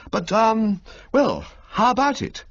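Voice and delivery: fumbling to ask for cash; awkward young toff. Acted. Bertie to Old Little about the allowance, awkward high toff voice.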